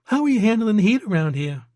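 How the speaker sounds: high-pitched waitress from Georgia; friendly, lilting high Georgia accent. A narrator's reading. Waitress chatting about hometowns, Georgia accent. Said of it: "an accent that was distinctly from Georgia".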